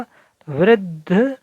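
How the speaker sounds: वृद्ध said slowly, द stopped with no vowel; half-letter demo. Shown as a demonstration. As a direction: show the halant in वृद्ध slowly. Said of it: "हलंत लगा है".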